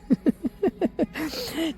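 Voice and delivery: a short high burst of laughter at her own joke; giggling about being the only voice in the shop; amused laugh. Real, not acted.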